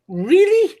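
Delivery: high, mock-incredulous really; imitating scornful Twitter replies, high and disbelieving; mocking reply. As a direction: parody the doubters with a squeaky really. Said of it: "some of the replies were like".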